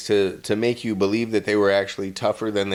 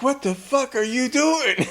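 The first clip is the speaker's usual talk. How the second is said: much higher, panicked shout; alarmed wrangler. Retold or imitated. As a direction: freak out at the intruder. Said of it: "the alligator wrangler is like".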